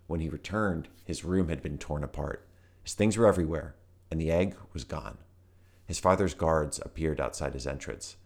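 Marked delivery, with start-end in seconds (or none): none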